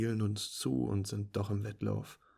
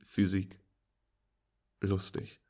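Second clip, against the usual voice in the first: usual speaking voice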